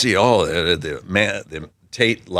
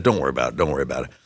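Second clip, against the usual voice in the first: calm reassurance; 9 semitones lower, 6 dB quieter, much faster than usual. Retold or imitated. Old stable man reassures startled kids, low soft calm. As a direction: lower and softer, unhurried. Old guy calming the riders.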